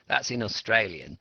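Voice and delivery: usual speaking voice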